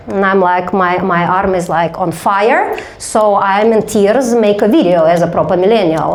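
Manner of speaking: normal talking voice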